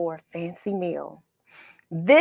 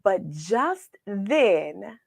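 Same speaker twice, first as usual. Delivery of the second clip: ordinary delivery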